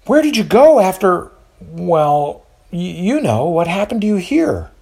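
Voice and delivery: high, hesitant motherly question. A narrator's reading. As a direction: ask eagerly, stumbling a little. Eunice asking eagerly.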